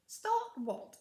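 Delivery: loud innocent tone. A narrator's reading. Ethel feigning innocence to needle Mildred, loud. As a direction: play dumb, loud and wide-eyed. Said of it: "exclaimed Ethel loudly in an innocent tone".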